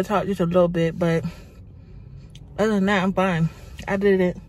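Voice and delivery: talking around a fresh tongue piercing. Real, not acted. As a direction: speak as if the tongue is tender. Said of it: "That's why I'm talking so weird".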